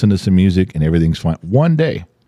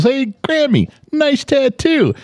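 very high, slow sing-out falsetto; squeaky-high mock granddaughter voice, last word stretched out; imitated little kid; slower than usual. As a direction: mimic a small child's teasing remark. Imitates four-year-old granddaughter's remark.

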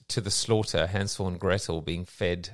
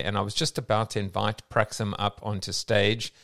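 usual speaking voice

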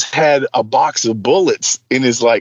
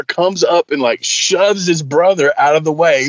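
usual speaking voice

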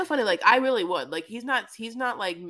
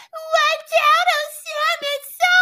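high, loud squeal of a woman on a runaway donkey; hammed-up high panicked cry; mock panicked Debbie; 11 dB louder, slower than usual. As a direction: squeal it like she's bouncing off a donkey.

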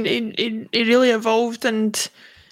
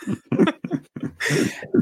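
laugh; 7 semitones higher than usual. Real, not acted. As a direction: let out a real chuckle. Laughs after dismissing a player.

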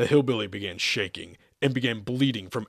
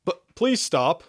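high, louder pleading call; negotiator's plea; 8 semitones higher, 5 dB louder, slower than usual. A narrator's reading. Negotiator begs attacker to stop, pleading. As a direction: plead in a raised pitch. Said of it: "the negotiator repeated".

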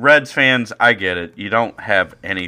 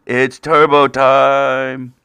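slow, louder drawn-out catchphrase; Turbo Nick voice; 3 semitones higher, 7 dB louder, much slower than usual. Acted. Host does the voice once more, slow and loud. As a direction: drag out the words like a dopey kid. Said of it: "I should stop doing that voice".

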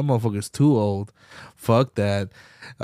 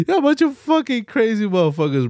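higher, laughing through the words; 11 semitones higher than usual. Real, not acted. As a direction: laugh while you say it again. Repeats the joke, laughing.

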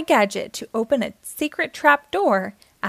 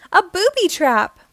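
high, loud alarmed exclamation; dismayed cry; 8 semitones higher, 5 dB louder than usual. A narrator's reading. Uniqua realizes it is a booby trap, alarmed cry. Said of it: "cried Uniqua".

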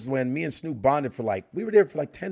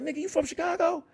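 [name] impression; 4 dB quieter than usual. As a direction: do a high [name] voice. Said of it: "He's like".

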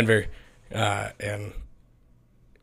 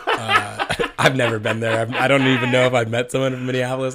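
chuckling through the words; laughing; 4 dB louder than usual. Real, not acted. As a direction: laugh while admitting it. Laughing at own trash talk.

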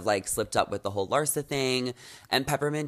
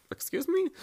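very high, softer questioning squeak; high-pitched retold reaction; 5 dB quieter than usual. Host imitating Phaedra reacting to her fellow player, high-pitched mock question. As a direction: lift it way up into a small, high question. Voicing Phaedra's offended reply in a retelling.

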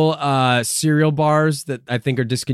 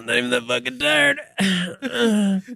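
slow, drawn-out, higher show-off brag; mock flexing; 11 semitones higher, much slower than usual. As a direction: stretch it out like you're showing off.

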